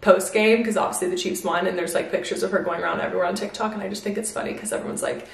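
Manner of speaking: usual speaking voice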